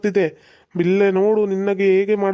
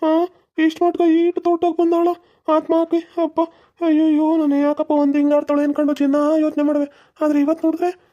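a much higher, rattled villager voice; frightened husband; 8 semitones higher than usual. Acted. Husband telling how his wife was possessed, high and rattled. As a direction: tell it still shaken by the wife's possession.

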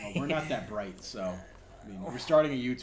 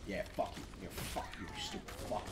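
whispered curse under breath; muttered insult; 9 dB quieter than usual. Real, not acted. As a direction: hiss it softly at the enemy. Muttering at enemy in game.

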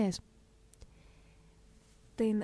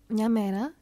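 ordinary talk, nothing marked

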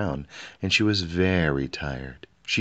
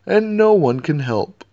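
much higher, louder despairing cry; howling king; 11 semitones higher, 10 dB louder than usual. A narrator's reading. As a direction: cry it out hopelessly. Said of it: "howled the king".

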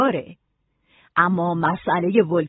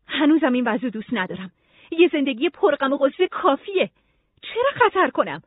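higher-pitched protesting refusal; upset refusal; 6 semitones higher, 3 dB louder than usual. Acted. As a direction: refuse plaintively, pitch climbing to the question. Nora resists choosing another life. (با اعتراض و صدای زیر)

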